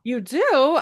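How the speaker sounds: startled disbelief; surprised. Retold or imitated. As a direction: caught off guard, asking back. Sister announces she wants to guest.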